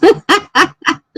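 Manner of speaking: sudden high laugh; high burst of laughter. Real, not acted. Laughs at chat message.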